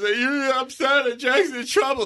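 high, slow, whiny mock sobbing; crying older child. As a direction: imitate a kid wailing on a sibling's behalf. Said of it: "The older one's now crying".

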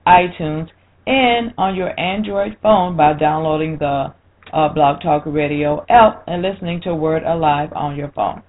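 bright, loud show-intro delivery; radio announcer read. Acted.

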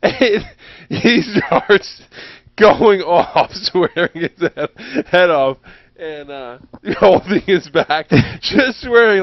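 words bursting out between laughs; cracking up mid-story; laughing. Real, not acted. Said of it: "can't even tell the story without laughing".

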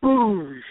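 a short, punchy mock "boosh" of a tree giving way; playful sound effect of a tree snapping over; imitated tree crash. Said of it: "You hear it go".

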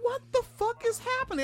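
very high, squeaky shocked voice; high-pitched horrified kid asking about the parents; shocked disbelief. Retold or imitated. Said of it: "And I'm just like".

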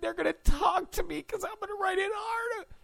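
very high, fast, shrieking; mock excited shriek. Host mocking the reporter's dream, shrieking high and fast. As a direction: shriek it in hammed-up glee.

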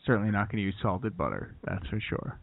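timid TV cook avoiding any excitement; Chef Neutral. Acted. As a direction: murmur it low and noncommittal. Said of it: "everything Chef Neutral makes is, like, very bland".

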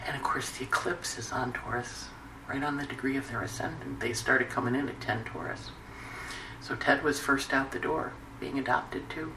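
real hushed, tearful telling; close to tears. Real, not acted. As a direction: hold back tears, voice dropped soft. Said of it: "I'm gonna cry again".

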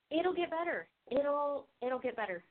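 quiet self-reassurance. Real, not acted. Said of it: "At confession".

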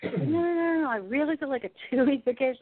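high, emphatic repeated denial; insistent. Retold or imitated. Client assures healer of the benefit, insistent. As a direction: imitate someone insisting, leaning on "really".

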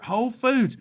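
ordinary delivery

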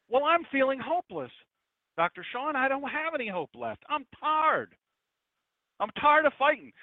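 mock weary believer. Voicing a hopeless believer.

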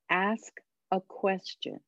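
each word given room, slowly; slow, deliberate tip. Shown as a demonstration. Coach handing listeners the magical fix, slow and deliberate. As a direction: spell out the key advice word by word, unhurried.

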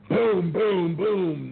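loud booming knock sounds, each one falling; imitated door-pounding; mock knocking. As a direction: bang it out like a fist on a door. Imitating the delivery man's knocking.

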